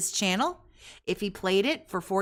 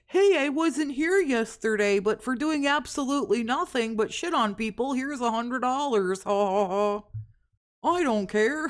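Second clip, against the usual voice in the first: raised, mocking internet troll taunt; 5 semitones higher than usual. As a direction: jeer like a smug troll. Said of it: "some troll might come out of the woodwork and say".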